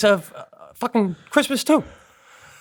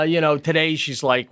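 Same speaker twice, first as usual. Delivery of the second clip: ordinary talk, nothing marked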